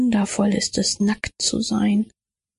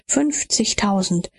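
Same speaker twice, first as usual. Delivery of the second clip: plain reading voice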